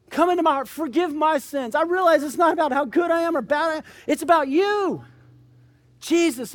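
earnest prayer. Acted. Preacher leading the congregation in prayer, fervent pleading prayer. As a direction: voice rises high and loud in a pleading prayer.

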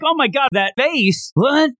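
high, brash challenge; mock tough guy. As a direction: snap it out like a cocky brawler. Boar guy's challenge acted out.

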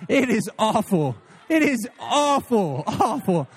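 laughing it out, slow on the repeat; chuckling disgust at the city. Real, not acted. Laughing repetition about Tulsa.

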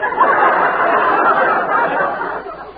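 big burst of laughter; laughing. Acted. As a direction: laugh out loud at the mixup. Beulah laughs at Oriole's mixup.

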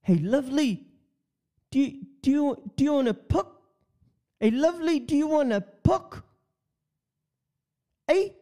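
high, hammed-up Kiwi come-on; a cheesy Kiwi chatting someone up; bad New Zealand accent. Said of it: "I'm terrible with accents".

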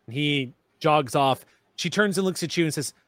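normal talking voice

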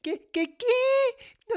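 rising to a high, loud squeal; shocked disbelief. Acted. Child learns cousins won't come this year, shocked.

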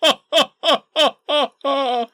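high, loud forced cackle imitation; hammed-up fake laughing fit; mock laughter. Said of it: "he just starts laughing like".

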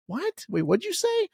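normal talking voice

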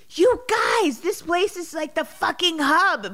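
high and loud, bursting with delight; excited outburst. Retold or imitated. Guest in their living room, exclaiming loud and high with glee. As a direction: retell his thrilled cry about the house. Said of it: "he said it in his Scottish brogue".

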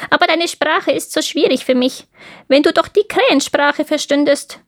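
high crow voice; raspy cawing crow. Acted. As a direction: chatter like a crow. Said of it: "sagte die Krähe".

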